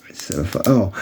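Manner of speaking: ordinary delivery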